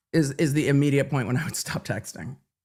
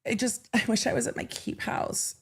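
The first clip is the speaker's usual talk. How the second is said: mock dreamy voice; 6 semitones higher, 7 dB quieter than usual. Mocking his text, higher.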